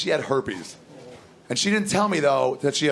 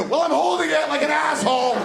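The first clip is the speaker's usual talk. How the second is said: high shouted comeback; husband shouting back at his wife, angry high shout; angry retort; 12 semitones higher than usual. Retold or imitated. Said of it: "She's like".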